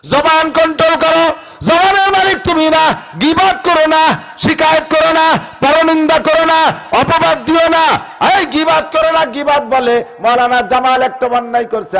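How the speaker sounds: ringing preacher's chant; full-force preaching. Acted. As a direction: call it out to the whole mosque.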